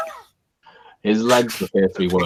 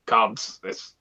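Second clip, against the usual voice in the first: groaning in pain; 3 semitones higher, slower than usual. Acted.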